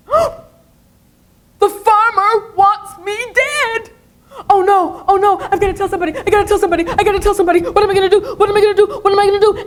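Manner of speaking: high, frantic squeaky mouse voice; panicked mouse. Acted. Mouse in a high frantic panic. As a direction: mouse telling itself in panic to tell somebody. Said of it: "And so the mouse ran".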